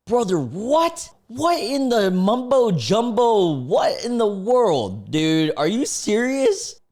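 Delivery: high, slow, stunned what; incredulous, drawn out; can't believe what he reads; shocked disbelief. Real, not acted. Shocked by taxi message.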